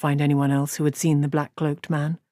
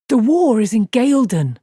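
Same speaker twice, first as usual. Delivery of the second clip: sharp, louder and higher snap; snapped; 6 semitones higher, 6 dB louder than usual. Acted. Rand snaps at his friends, sharp and loud. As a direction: bite the words off in anger. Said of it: "Rand snapped".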